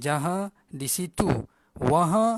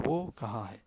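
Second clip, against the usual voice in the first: "Wo kaha hai" lower and softer, on one even beat; even rhythm, no intonation; 5 semitones lower, 5 dB quieter than usual. Shown as a demonstration. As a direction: keep "Wo kaha hai" level like a news reader. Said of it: "Rentak ni sama saja". ("Wo kaha hai" rentak sama, tanpa intonasi)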